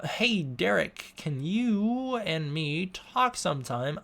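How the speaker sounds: put-on reading each word slowly like a small child; slow childlike reading. Shown as a demonstration. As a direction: sound it out word by word like a three-year-old. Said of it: "I have to read like I'm a three-year-old".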